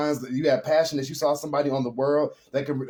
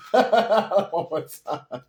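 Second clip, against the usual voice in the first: laughing; 6 semitones higher, much slower than usual. Real, not acted. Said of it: "I can't even, y'all".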